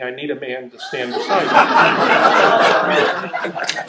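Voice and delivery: laughing through the song line; song quoted laughing. Retold or imitated. Preacher retelling the song on the radio, laughing through it. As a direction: giggle as you quote the lyric. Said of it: "this woman singing".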